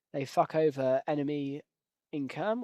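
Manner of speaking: normal talking voice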